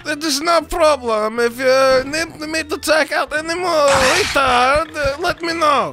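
mock Kazakh accent; Borat. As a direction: put on a comic foreign-visitor accent. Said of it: "Yo, Borat".

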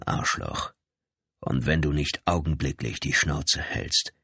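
low, controlled warning voice; menacing police lieutenant. Acted. Lieutenant warns the brother face to face, low menace. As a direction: drop the pitch and threaten with restraint.

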